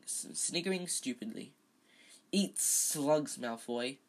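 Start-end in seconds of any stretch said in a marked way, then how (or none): none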